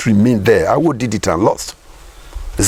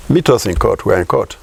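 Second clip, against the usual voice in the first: ordinary talk, nothing marked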